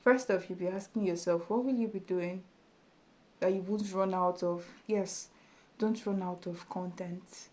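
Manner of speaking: ordinary talk, nothing marked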